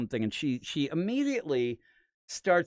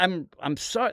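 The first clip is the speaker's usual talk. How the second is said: ordinary delivery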